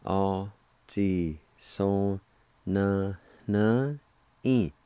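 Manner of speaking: "A ta tle ti" split into separate syllables; slow syllable-by-syllable teaching demo. Shown as a demonstration. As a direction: pause after each syllable of "A ta tle ti". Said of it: "It's pronounced like this".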